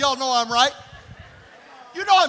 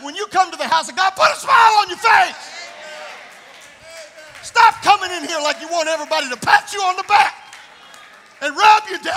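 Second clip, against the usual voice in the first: rising to a loud, high preaching shout; full-force preaching; 4 semitones higher, 8 dB louder than usual. Acted. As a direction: drive it home from the pulpit.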